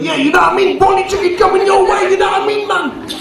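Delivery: high-pitched mock Jamaican patter; put-on Jamaican accent. Curry house man answers a customer's order, in a high mock Jamaican accent. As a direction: imitate an old man's put-on Jamaican accent, pitched right up. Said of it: "He was putting on a Jamaican accent".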